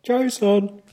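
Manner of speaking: loud high call of a name; calling out Jason. Retold or imitated. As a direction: shout for a lost son. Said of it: "walking around going".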